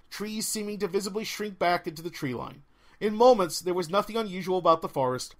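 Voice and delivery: plain reading voice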